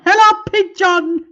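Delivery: loud, high "Hello, pigeon!"; pigeon guy voice. Retold or imitated. Mimicking the pigeon video for chat, loud and high. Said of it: "I've just gone".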